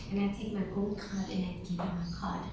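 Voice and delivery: hushed whisper. Retold or imitated. Telling the girls how she paid with gold card. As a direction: breathe it like a secret aside.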